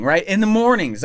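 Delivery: ordinary talk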